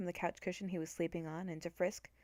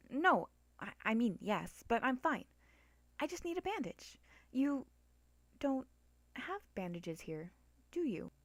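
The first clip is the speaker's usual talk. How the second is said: put-on a slightly higher, hesitant girl's voice; lightly voiced young girl; 5 semitones higher than usual. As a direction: play Frisk lightly, a bit flustered. Frisk's voice, narrator plays.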